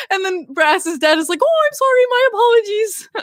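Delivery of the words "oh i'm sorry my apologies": high-pitched imitation of an apology; mock apologetic dad. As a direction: play the flustered dad saying sorry. Acts out dad apologizing to interviewer.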